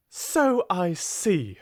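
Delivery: slow, cutting, scornful; withering. Acted. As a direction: drag it out with icy scorn. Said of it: "said Miss Minchin witheringly".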